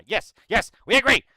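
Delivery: high, loud mock concession; exaggerated yes-yes agreement; impatient agreement. Waves off expected objections.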